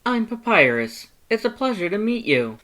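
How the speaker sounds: high, loud cheerful skeleton; Papyrus voice. Acted. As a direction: introduce yourself way up high with gusto. Papyrus introduces himself.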